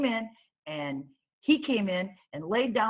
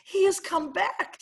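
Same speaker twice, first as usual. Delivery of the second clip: high, shaken gasp of a word; an old woman on the floor, still scared of a bear; frightened old lady; 12 semitones higher, 5 dB louder than usual. Acted. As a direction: breathe it out in fright.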